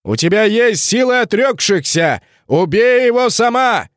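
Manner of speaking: booming high commanding voice; dark lord. Acted. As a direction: command her like a dark sorcerer. Necromancer commands from above.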